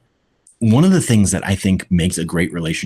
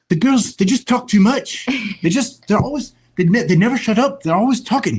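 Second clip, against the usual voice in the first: put-on much higher, fed-up small boy with a British lilt; frustrated little British boy. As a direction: complain like a grumpy four-year-old in the back seat. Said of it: "This little British boy".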